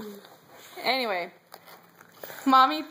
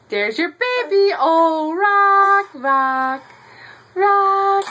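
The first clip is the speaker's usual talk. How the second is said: high, loud encouraging cheer; excited cheering; 9 semitones higher, 8 dB louder, much slower than usual. Real, not acted. Mom loudly cheers toddler rocking her doll.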